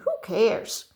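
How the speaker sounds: ordinary talk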